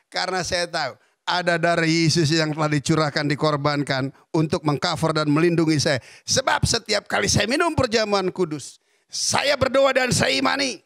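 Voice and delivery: loud preaching proclamation, slowing at saya imani; full preaching. Acted. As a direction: proclaim it with conviction to the congregation. Proclaiming Jesus' blood. (khotbah lantang penuh keyakinan)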